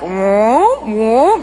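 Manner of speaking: low, drawn-out rising "whoa"; stretching noise. Retold or imitated. Superhero stretching out to save someone falling, playful swooping sound. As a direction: imitate rubbery arms stretching out, pitch sliding up.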